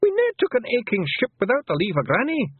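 high, loud little Scots pixie, caught out; a cheeky pixie squirming for an excuse; squeaky, guilty Feegle stalling; flustered Feegle. Acted. Rob Anybody protests.

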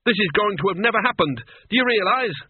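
shrill panic as he loses his nerve; hysterical. A narrator's reading. Wizard to the Duke, hysterical and panicked. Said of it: "said Cutwell hysterically".

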